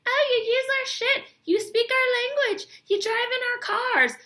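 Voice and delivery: high, whining mock complainer; whiny mock voice. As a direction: pitch it up and whine like a petulant commenter.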